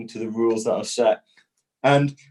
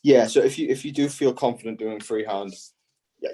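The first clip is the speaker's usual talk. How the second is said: ordinary delivery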